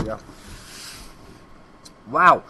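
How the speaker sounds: ordinary delivery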